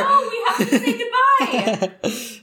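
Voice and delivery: slow, loud laughing protest. Real, not acted. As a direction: insist through laughter.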